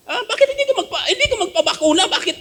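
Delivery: high, fast, laughing mock question; mock the critics' nagging tone; mocking critics. Mocking what critics ask. (panunuyang tanong na may tawa)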